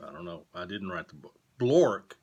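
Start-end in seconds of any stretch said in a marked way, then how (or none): 1.6-2.1 put-on loud, falling BLORK, announced to kids; silly storybook sound; nonsense word. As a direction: bark the made-up word.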